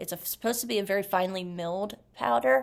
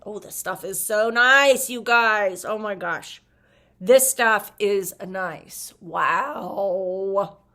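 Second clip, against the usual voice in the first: louder, gushing over the powder, slowing on "nice"; real excitement; 8 dB louder, much slower than usual. Real, not acted. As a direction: swoon loudly over how nice it feels. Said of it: "I'm getting a little bit excited there".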